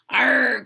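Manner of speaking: loud pirate arr; battle roar. Pretend. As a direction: growl like a pirate. Pirate growl in outro.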